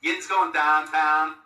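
slow Pittsburgh Yinzer demo; Pittsburgh accent. Shown as a demonstration. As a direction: stretch out "downtown" as "dahntahn" in a Pittsburgh accent. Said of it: "it's a Pittsburgh thing".

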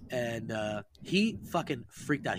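ordinary talk

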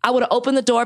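normal talking voice

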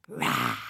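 very low roar. A narrator's reading. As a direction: let out a deep beastly roar. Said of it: "and roared".

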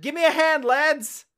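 high Cockney call; loud Cockney shout to the lads; Cockney accent. Retold or imitated. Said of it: "like, a Cockney accent".

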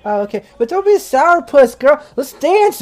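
high tipsy coaxing voice; drunk party girl. Acted. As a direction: wheedle like a drunk girl wanting to dance. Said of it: "She's like".

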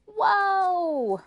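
long, very high holler; crying out. Acted. May May crying out to stop the horse, long holler. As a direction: yell it long and loud. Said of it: "cried May May".

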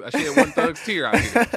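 mock tough guy, laughing; laughing about a tear. Real, not acted. Guest laughing at his own tears on the podcast. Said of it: "Had me a little tear".

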